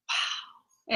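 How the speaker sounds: hushed, breathy wow; stunned. Retold or imitated. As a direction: let it out as an awed whisper. Retells being stunned by son's words.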